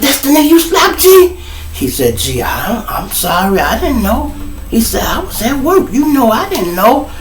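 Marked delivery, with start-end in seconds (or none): 0.0-1.4 very high disbelieving rise; mock amazed general; surprised question. 2.2-7.1 high soft scared voice; hint of a frightened inmate; mock pleading apology. Victim apologizing.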